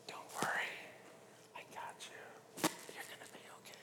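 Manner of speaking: unvoiced, barely audible whisper; very soft whisper. God swimming up to someone in trouble, very soft whisper. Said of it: "it's gonna come very softly".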